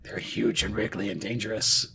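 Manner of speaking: low, soft in-character warning; hushed warning. Acted. Acting out the chosen option to boy.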